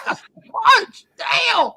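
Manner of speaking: high, loud outraged cry; outraged shout. Real, not acted. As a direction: shriek it like the price hurts. Outraged at the asking price.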